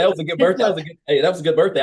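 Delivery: bubbling over with happy surprise; delighted exclamation. Real, not acted. Laughing over son's lucky birthday.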